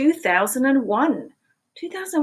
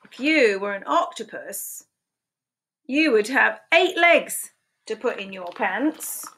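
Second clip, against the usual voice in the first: lively picture-book read-aloud, questions pitched high; animated storytime voice with playful questions; reading to children; 6 semitones higher than usual. Acted. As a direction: read it like storytime for little ones.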